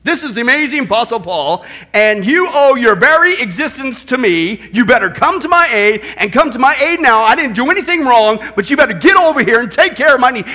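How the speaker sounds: loud, indignant demand; forceful, self-important tirade; entitled attitude. Acted. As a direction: bellow it like someone who is owed everything. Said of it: "He could have had that attitude".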